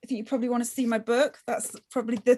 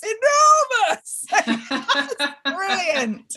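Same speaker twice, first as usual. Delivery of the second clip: much higher and louder, bursting into laughter; excited laughing shout; 7 semitones higher, 3 dB louder, much slower than usual. Real, not acted. Author thrilled at her award trophy, laughing excited shout. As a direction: gush with delight through laughter.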